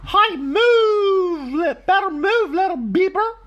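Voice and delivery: very high, loud squeaky holler. Acted. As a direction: holler as a bossy street sweeper.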